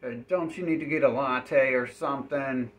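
loud, fed-up complaint at the NPC; loud annoyed rant to a stuck NPC; exasperated yelling. Retold or imitated. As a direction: yell at him like he's wasting your time. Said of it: "And I'm like".

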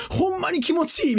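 high-pitched mock Kansai accent; joking Kansai-dialect impression. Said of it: "関西弁のエッチ".

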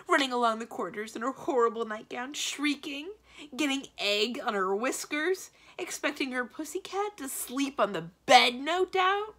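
loud, high, escalating tirade; indignant rant. A narrator's reading. Magician lists a witch's horrors, indignant ranting. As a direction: pile up complaints, rising in outrage.

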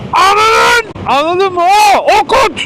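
high, loud, pressing anger; exasperated yell. Retold or imitated. Courier retelling how he scolded the customer, angry high yell. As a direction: drive the point home furiously.

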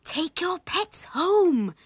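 high, loud, drawn-out order; slow, high maternal outcry; mother crying out. A narrator's reading. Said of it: "cried Willie's mother".

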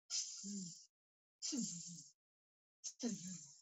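soft hissing tss tss tss; quiet teasing monkey hiss; three soft mocking tss sounds. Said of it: "and said".

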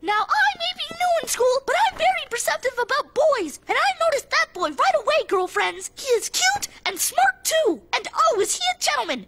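put-on high, gushing girl disguise. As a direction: gush like a smitten schoolgirl. Disguised boy praises himself as girl.

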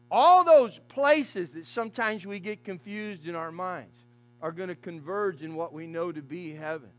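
ordinary delivery

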